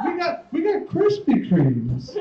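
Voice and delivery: mock police officer; high mock cop voice. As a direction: play the cops' eager reply in a high voice. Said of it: "It was like".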